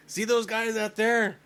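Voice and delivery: high, slow, tipsy drawl; drunk comedian. Retold or imitated. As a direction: play the wasted comic on stage. Comic quoting the drunk comedian.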